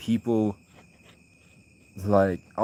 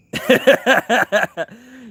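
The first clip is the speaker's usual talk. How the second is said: loud high laugh; 13 semitones higher, 7 dB louder than usual. Real, not acted. Streamer bursting out laughing at a chat message.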